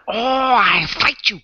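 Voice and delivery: light mocking Chinese accent; a hint of a put-on Chinese accent, playful challenge. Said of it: "being this Chinese man".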